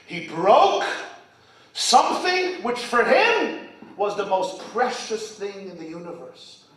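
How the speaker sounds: ordinary talk, nothing marked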